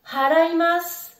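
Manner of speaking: haraimasu with a strong breathed H; aspirated H. Shown as a demonstration. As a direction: exhale hard on the H of haraimasu. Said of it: "le souffle sur ta main". (haraimasu, H bien expiré)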